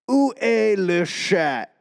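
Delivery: careful French phrase spoken into an app; attempted French. Learner answering Duolingo aloud, attempted French. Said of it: "you say it like".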